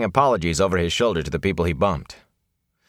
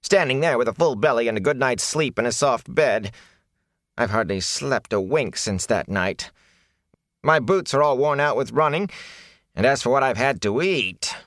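whining; 6 semitones higher than usual. A narrator's reading. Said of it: "Fane whined".

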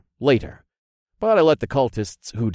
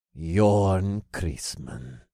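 put-on very slow, lower, resigned older man; sighing, defeated; 4 semitones lower, much slower than usual. A narrator's reading. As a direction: let the name out like a weary admission. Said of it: "The older man sighed".

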